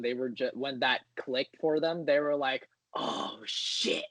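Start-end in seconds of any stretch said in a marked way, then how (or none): 2.9-4.0 whispered, stretched-out mock shock; imitated stunned reaction. Retelling the theater's reaction when it clicked, stunned mock whisper.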